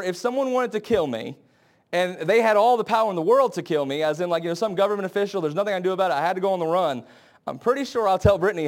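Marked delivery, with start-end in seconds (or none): none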